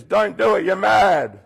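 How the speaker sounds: loud, high urgent warning. Acted. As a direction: shout the warning like a conscience. Conscience voice warns the child.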